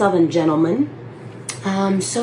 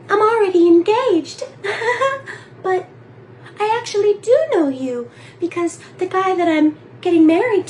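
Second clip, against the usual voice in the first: much higher, giggly woman's voice; mock blonde actress; 8 semitones higher than usual. Reenacting the actress turning him down, high and giggly. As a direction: squeak it out as the cute blonde. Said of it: "She's a cute blonde".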